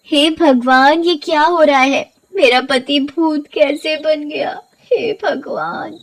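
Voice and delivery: high, slow, frightened cry; quavering fearful prayer; terrified. Acted. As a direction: gasp in horror at the sight. Wife sees husband as ghost.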